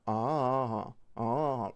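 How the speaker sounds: drawn-out, loud "Ah", imitated cadence; Korean sentence-ending cadence. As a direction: stretch each "Ah" like a foreign sentence ending. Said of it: "sentence will have this kind of cadence".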